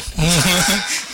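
a quick real chuckle; brief amused laugh; short laugh. Real, not acted. Laughs at the father story.

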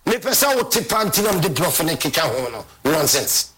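ordinary talk, nothing marked

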